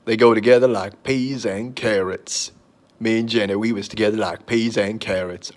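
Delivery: a hint of the simple-hearted movie hero; movie hero impression. Movie quote impression, Jenny line.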